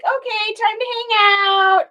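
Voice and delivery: high, bright dog voice; voicing the dog. Owner voicing the dog lying on her arm, high voice. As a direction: speak for the lazy dog. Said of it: "She's like".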